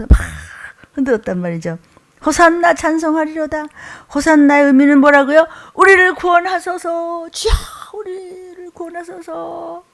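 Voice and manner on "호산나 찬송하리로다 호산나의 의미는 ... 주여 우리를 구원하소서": high, mildly fervent pleas of the crowd; crowd crying out. Retold or imitated. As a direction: cry out to the savior with joy. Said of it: "막 소리소리 지르는 거예요". (기뻐 외치는 무리 흉내)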